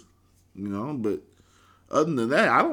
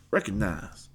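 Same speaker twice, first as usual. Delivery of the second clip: ordinary delivery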